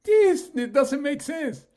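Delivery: very high, loud and slow; exasperated cry. Real, not acted. To the group, loudly disbelieving the friend's choice. Exasperated outburst, corrected word.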